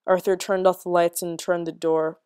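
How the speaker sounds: ordinary delivery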